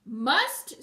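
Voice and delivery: loud, rising shriek of disbelief; indignant rising yell; screamed outrage. A narrator's reading. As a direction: scream it as a furious question. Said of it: "screamed the princess".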